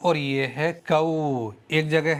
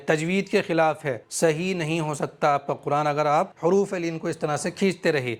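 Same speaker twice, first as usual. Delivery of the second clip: usual speaking voice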